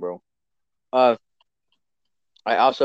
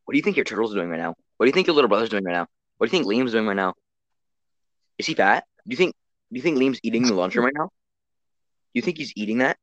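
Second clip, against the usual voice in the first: rapid teasing questions; faster than usual. Retold or imitated. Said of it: "Brody's going".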